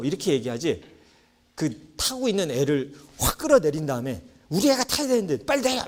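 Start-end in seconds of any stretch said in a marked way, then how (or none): 4.5-5.8 high-pitched mock demand at another child; shrill-free mock yell, much higher, insisting the kid get off; pushy parent yelling. Rude parent yanks a kid off the swing, mock angry high demand. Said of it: "막 이러는 부모는".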